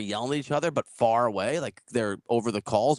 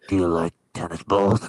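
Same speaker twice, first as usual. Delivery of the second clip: odd drifter voice; 9 semitones lower than usual. Retold or imitated. Man imitates the tennis drifter asking why people say shoot. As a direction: play the creepy stranger puzzling over a word. Drifter asks about dog.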